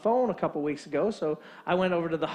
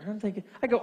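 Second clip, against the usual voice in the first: normal talking voice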